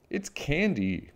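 high, stretched-out exclamation; storybook child's excited discovery; exclaiming. A narrator's reading. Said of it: "cried Cherry".

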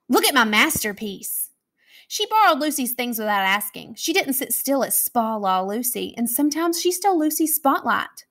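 usual speaking voice

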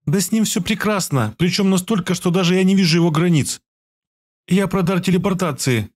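put-on a young woman explaining a gift with care; young healer girl. A narrator's reading. Healer girl explains his gift to him, earnestly. Zakharia voiced by narrator.

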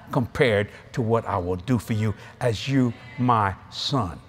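slow, low, weighty divine pronouncement; voice of God. Retold or imitated. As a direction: declare a solemn promise, word by word. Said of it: "He says".

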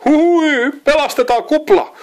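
high, loud, breathless bird cry; panting alarm. A narrator's reading. Jimi urges his brothers to save Bubble, panicked and high. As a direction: gasp it out in a panic. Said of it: "huohotti Jimi".

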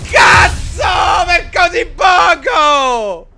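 explosive Italian cursing, yelled high; furious shout. Real, not acted. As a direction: scream the curse in frustration. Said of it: "scusate se ho urlato".

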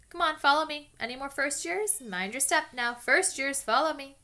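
big friendly giant calling first years. Acted. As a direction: call the crowd over. Hagrid calling to students.

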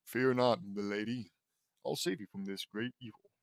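deep knight voice. Acted. Irritated sister reading a knight's lines to Lola, lightly deep. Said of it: "Laney reads in a deep voice".

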